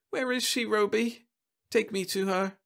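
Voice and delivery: put-on high pleading child; a lost small girl; little girl voice.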